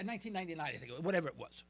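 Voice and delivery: usual speaking voice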